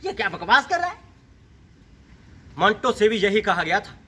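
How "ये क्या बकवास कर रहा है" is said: mock outraged listener, pitch climbing; imagined heckler. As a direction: mimic an indignant audience member. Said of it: "आप सोच रहे होंगे".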